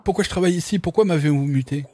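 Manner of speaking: put-on high, pleading young recruit; anxious young man questioning. Voices young protagonist.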